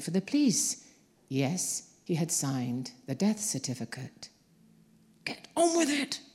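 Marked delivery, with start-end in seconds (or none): none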